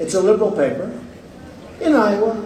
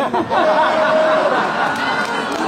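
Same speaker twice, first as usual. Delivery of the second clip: laugh; 4 semitones higher than usual. Real, not acted. Laughs at the headline.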